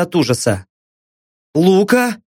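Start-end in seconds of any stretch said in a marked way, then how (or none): 1.5-2.2 cautious call. A narrator's reading. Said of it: "осторожно позвал он".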